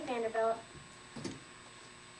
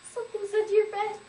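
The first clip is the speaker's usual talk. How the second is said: panicked mother; 6 semitones higher, 5 dB louder than usual. Acted. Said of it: "when she stopped screaming".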